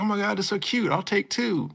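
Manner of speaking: put-on high gushing girl voice; hammed-up cutesy squeal; mock gushing. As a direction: squeal over something cute. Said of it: "she's like".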